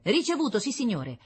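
high-pitched radio report; eager scout. Acted. Scout describing red spheres to the general, high and dutiful. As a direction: report in a high, measured voice to a superior.

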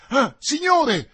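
high, loud alarmed cry; yell. Acted. Planchet yells to his master, high and loud in alarm. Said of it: "urlò Planchet".

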